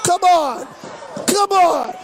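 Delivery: loud urgent come on; urgent plea. Real, not acted. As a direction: push the ref to act. Urging referee.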